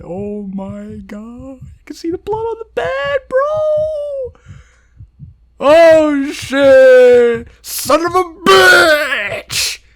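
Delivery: very high, loud horrified shout; horrified yelling at the screen; shocked scream. Real, not acted. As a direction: scream it out in disgust. Player screaming at gore.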